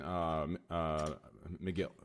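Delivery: usual speaking voice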